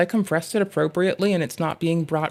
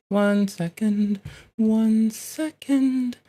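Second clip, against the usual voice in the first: high, slow sing-song stalling; drawn out playful singsong; 5 semitones higher, much slower than usual. Pretend. Sing-song stalling while working.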